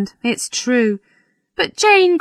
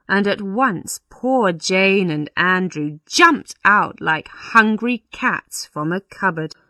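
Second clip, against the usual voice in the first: ordinary delivery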